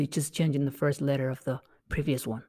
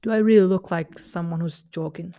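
louder exasperation; 3 semitones higher, 6 dB louder than usual. Shown as a demonstration. Child to the dad, louder and exasperated. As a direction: push it out, annoyed and loud. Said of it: "you're like".

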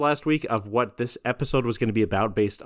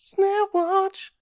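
squeaky high voice. Acted.

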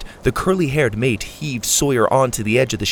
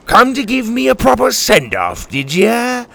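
higher, taunting sneer; mocking pirate; 10 semitones higher, 4 dB louder than usual. A narrator's reading. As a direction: taunt the old rival. Captain mocks rival.

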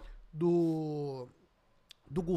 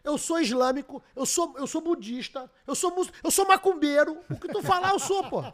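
excited boasting; 6 semitones higher, 7 dB louder than usual. Real, not acted. Boasting, laughing, loud.

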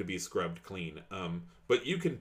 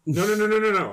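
excited; 10 semitones higher, 9 dB louder, faster than usual. Real, not acted. Excited objection.

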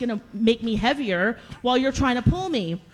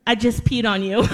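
punchline delivered louder, breaking into laughter; laughing confession; 4 dB louder than usual. Retold or imitated. Storyteller confesses to the friend whose back she's on, laughing. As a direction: blurt it out and crack up.

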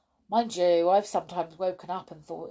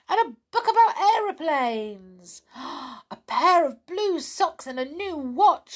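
higher, excited call; shouted; 9 semitones higher, 7 dB louder than usual. Acted. Patter calling out his presents, excited shout. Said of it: "shouted Patter".

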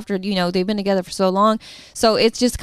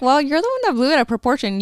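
quick, higher-pitched argumentative comeback; defensive retort; 7 semitones higher, faster than usual. Retold or imitated. Girlfriend replaying her side of the fight, defensive retort. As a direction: throw the blame back fast, in mock argument. Acted quote from their argument.

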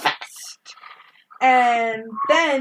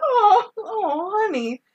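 mock comfort; 10 semitones higher, slower than usual. Comforting Billy mockingly.

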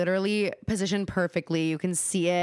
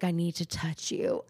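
soft, slow, husky come-on; imitating low husky voice; 2 semitones lower, 6 dB quieter than usual. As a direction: mimic his hushed, intimate murmur. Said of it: "low, like husky voice he's talking".

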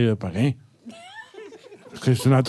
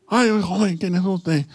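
lightly voiced old lady; old person voice; 3 semitones higher, slower than usual. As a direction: play a frail old voice. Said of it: "voz de persona mayor".

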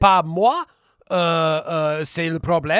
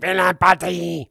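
mock French; 3 dB louder, slower than usual. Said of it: "do it one more time, even more, worse".

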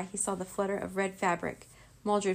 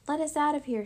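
higher, raised yell; demanding yell; 7 semitones higher, 4 dB louder than usual. A narrator's reading. Rory pounds on the locked door, yelling. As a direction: holler to be let out. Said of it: "she yelled".

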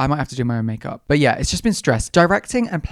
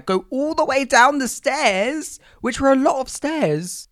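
exasperated exaggeration; 11 semitones higher, slower than usual. Real, not acted. Complaining about store stairs.

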